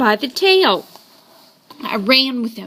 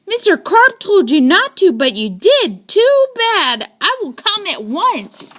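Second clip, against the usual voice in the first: lightly voiced policeman, higher and brisk; a hint of a no-nonsense cop; 7 semitones higher than usual. A narrator's reading. Policeman scolds the boy, then promises to come, lightly voiced. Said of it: "said the policeman".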